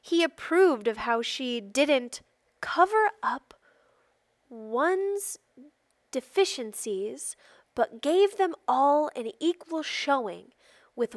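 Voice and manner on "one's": ordinary delivery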